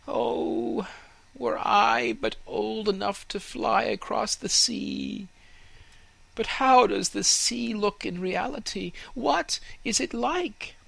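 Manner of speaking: put-on high, wistful young fir tree voice; a small tree yearning for the sea; young tree dreaming aloud. Fir tree longs to see sea.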